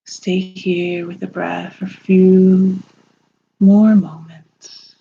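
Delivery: slow, soothing meditation voice with long pauses; unhurried, calming lead through a visualization; guided meditation. Acted.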